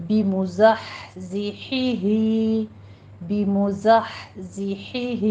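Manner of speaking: بمزحزحہ very slowly, each ha kept distinct; careful recitation. Shown as a demonstration. Teacher reciting بمزحزحہ for students to practise. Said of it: "دونوں ہا میں فرق کرنا ہے".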